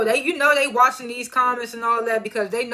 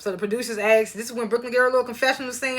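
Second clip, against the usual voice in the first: usual speaking voice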